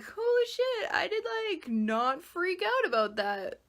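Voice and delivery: high, amazed reaction to yourself, slowing at the end; surprised. Retold or imitated. Imagined self, amazed at staying calm, high surprise. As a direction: pitch it up in happy disbelief. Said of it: "you'll be like".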